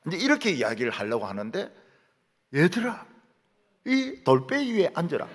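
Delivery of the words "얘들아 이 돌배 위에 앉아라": put-on 돌배 slipping out in a Gyeongsang accent, a bit higher; Gyeongsang-accented teacher, 돌배 for 돌 위; Gyeongsang dialect slip. Teacher slipping into Gyeongsang speech to children: Gyeongsang accent. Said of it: "이렇게 말이 나와버린 거예요".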